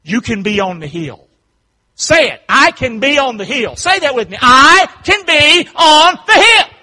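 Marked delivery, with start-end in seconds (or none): 2.0-6.7 high shouted rallying cry; loud, high preacher urging them to join in; rousing call-and-response preaching. Real, not acted. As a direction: lead the crowd at full volume. Leads congregation in chant.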